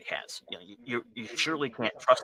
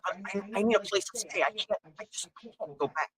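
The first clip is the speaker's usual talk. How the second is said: higher, softer voice of someone desperate; acted pleading; 7 semitones higher, 3 dB quieter than usual. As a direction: plead for shelter in a small high voice. Half-orc pleads for somewhere to stay.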